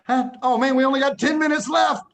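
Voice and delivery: loud, high cry of dismay; alarmed exclamation. Real, not acted. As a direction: blurt it out loudly, caught out by the clock. Suddenly notices time running out.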